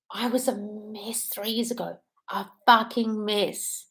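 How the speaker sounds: slow, breathy whisper, close to tears; almost whispering, tearing up; tearful whisper. Real, not acted. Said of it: "I'm tearing up just saying it".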